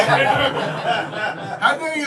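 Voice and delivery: drawn out, haughty and slow; slow, lofty mock affront with a laugh; classy indignation. Shown as a demonstration. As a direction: deliver it like an offended aristocrat. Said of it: "it's disrespectful, but with a little bit of class".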